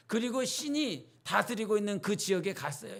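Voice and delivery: ordinary delivery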